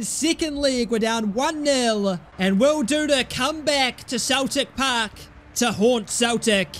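usual speaking voice

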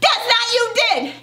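very high, squabbling comeback; high-pitched exasperated retort; escalating quarrel. A narrator's reading. Quarrel escalates after sniffed denial.

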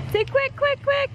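very high squeaky coaxing; baby talk to a toddler. Acted.

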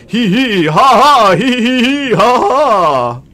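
loud eerie ghost laughter. Pretend. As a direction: cackle like a haunting ghost. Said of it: "Hope there's not too much ghost voice".

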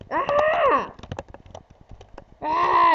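loud high panicked yell; high scream. Real, not acted. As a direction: scream out in alarm. Screams during crash.

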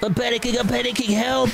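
high, hammed-up panicked cry for mom; mock panic. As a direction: play a kid panicking and yelling for mom.

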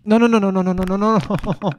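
loud, high rapid string of no's; real alarmed yelling; frantic no's. Real, not acted. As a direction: panic as it slips away. Watching the log slip away.

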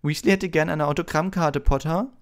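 high, loud jibe; sneering high schoolboy taunt; mocking Malfoy. A narrator's reading. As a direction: tease with open scorn. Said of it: "spottete Malfoy".

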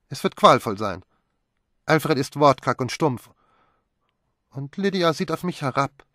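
a dramatic sulky lament; Magdalene dreading. A narrator's reading.

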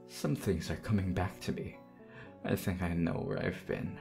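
low, soft, sinking toward a whisper; haunted hush. Acted. Undead lover recalling visions, haunted low hush. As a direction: recall horrors under your breath.

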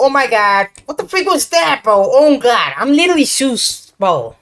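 shaken, loud and rattled after a scare; scared horror game streamer. Real, not acted. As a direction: blurt it out, still jumpy.

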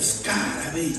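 whispered call for the ladder; voiceless dream call. Retold or imitated. Painter on the ladder calls from the dream, whispered. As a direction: try to shout but only whisper comes. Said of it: "El n-auze nimic".

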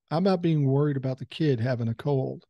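normal talking voice

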